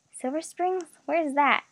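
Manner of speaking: mildly puzzled question; lost, not placing the name; confused. A narrator's reading. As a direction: raise it in uncertain bewilderment. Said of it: "Kelsey asked, confused".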